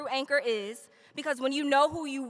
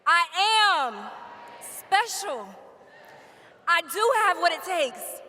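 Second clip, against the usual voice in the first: slow, louder lines for a crowd to echo; call-and-response affirmation; 3 semitones higher, 5 dB louder, slower than usual. Acted. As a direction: lead the crowd word by word. Leads graduates in affirmations.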